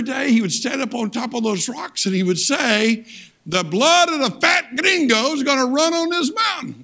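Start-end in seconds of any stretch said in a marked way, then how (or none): 3.5-6.8 retold threat. Angry local to the missionary from atop the rocks, threatening call. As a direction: call out a menacing threat, pitched well up.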